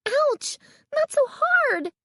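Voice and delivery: very high, hurt yelp; shrill pained squeal; pained cry. A narrator's reading. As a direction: cry out in protest at pain. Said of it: "Carly Beth cried".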